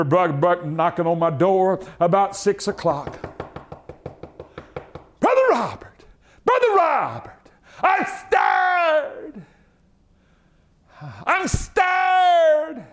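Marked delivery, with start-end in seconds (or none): 5.2-12.9 imitated high-pitched morning holler; old preacher's shrill wake-up call, imitated. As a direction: call out very high, dragging out the words. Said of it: "His was like high-pitched".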